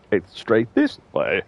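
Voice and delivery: slow, spaced-out words; slow deliberate. Shown as a demonstration. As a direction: draw out each word. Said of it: "space between the I and the T".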